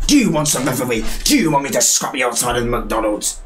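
normal talking voice

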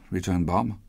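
plain reading voice

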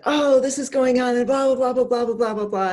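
mock the upset caller's rush of complaints; imitating upset daughter. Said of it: "and all upset".

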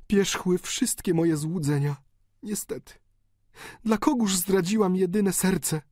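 high, desperate daughter's plea over a dying father; countess pleading. A narrator's reading. Countess begging her dying father for blessing, desperate high plea. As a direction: beg him to come back, voice high and torn.

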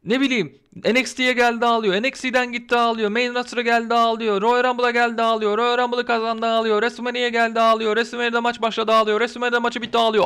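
high-pitched, relentless monotone listing; exasperated rant. Real, not acted. Complaining to viewers about Bianca always crying, exasperated rant. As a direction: pile up the complaints on one high note.

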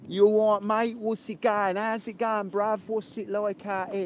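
high, put-on London geezer accent; hammed-up Cockney-style London banter; London street accent. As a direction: greet a mate in broad mock London slang. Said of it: "So I'm not".

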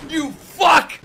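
high, loud yell imitating rage; pissed-off Yamato. Viewer voicing Yamato's anger, pissed-off yell. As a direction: yell it as a furious mock quote. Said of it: "He's so pissed".

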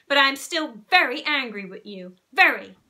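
a mother repeating "Very." with a loud final punch; stern emphasis. Acted. Mother stressing her anger.

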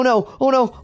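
normal talking voice

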